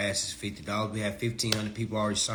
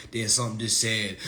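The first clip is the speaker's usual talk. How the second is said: ordinary talk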